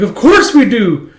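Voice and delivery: high, loud, drawn-out mock protest; indignant insistence. Boys answering mentor's doubt, indignant mock insistence. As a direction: insist on it, hammed-up.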